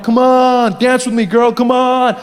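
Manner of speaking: insistent mock come-on; creepy guy. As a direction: imitate a pushy creep nagging for a dance. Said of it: "That creepy guy comes up".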